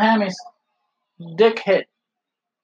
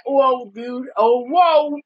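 high, stoked surfer-dude whoa; imitating a hyped skater; stoked skater drawl; 10 semitones higher, 3 dB louder, slower than usual. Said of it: "And then Sting said".

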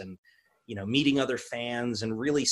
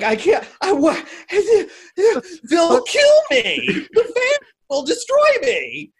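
high, laughing mock panic; panicked; 11 dB louder, slower than usual. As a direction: squeal it out in laughing dread. Retold panic about angry fans.

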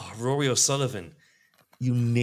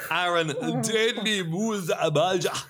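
higher, slow lingering Scottish accent; 8 semitones higher, slower than usual. Shown as a demonstration. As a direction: keep the Scottish accent going on the name. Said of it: "That's Scottish ac".

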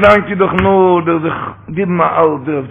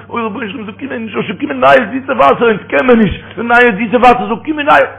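rising fervour; 8 semitones higher than usual. Acted. Exclaims in sermon.

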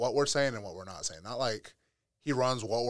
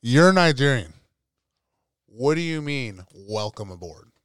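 mock disbelief; 9 dB louder than usual. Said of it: "And I was like".

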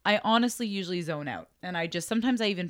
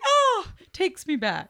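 nostalgic; 12 semitones higher, 4 dB louder, slower than usual. Retold or imitated. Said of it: "And I was like".

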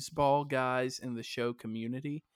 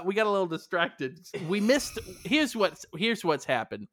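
normal talking voice

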